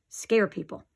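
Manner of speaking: ordinary delivery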